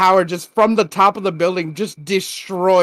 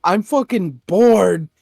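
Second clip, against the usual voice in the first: slow, drawn-out mock boredom; bored complaint; slower than usual. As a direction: drag it out, imitating someone bored stiff. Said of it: "Power's like".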